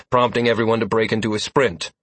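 ordinary delivery

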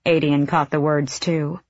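usual speaking voice

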